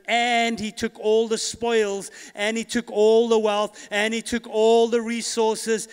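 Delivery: rising preacher emphasis; emphatic preaching. Acted. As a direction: hammer the word all, slowly.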